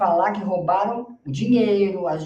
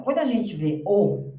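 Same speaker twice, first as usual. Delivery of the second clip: usual speaking voice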